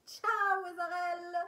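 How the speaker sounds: happily ditching Ezarel for Leftan; gleeful goodbye. Real, not acted. Cheerfully dumping one character for another.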